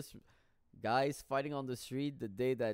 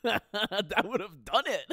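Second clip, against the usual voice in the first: slow, loud, higher laugh-through; 9 semitones higher, 9 dB louder, slower than usual. Real, not acted. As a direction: crack up while saying it. Laughing at his own joke.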